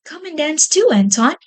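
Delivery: high, bright calling out to her brother; excited calling. Acted. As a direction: call him into the dance, high and eager. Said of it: "called Babette".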